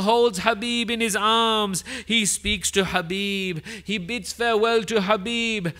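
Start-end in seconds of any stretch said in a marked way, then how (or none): none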